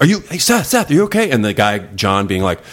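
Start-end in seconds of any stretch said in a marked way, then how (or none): 0.0-1.3 calling out worried. Retold or imitated. Waking him after fainting.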